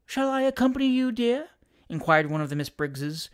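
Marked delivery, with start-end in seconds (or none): 0.1-1.5 high, sugary offer; bland false sweetness. A narrator's reading. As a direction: offer help sweetly to spoil it. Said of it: "with the bland intention of spoiling".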